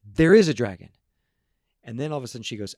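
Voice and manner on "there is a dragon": much louder exasperated insistence; boy finally snaps at his mom, loud outburst. Retold or imitated. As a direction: blurt it out, fed up. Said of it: "Billy finally loses it and goes".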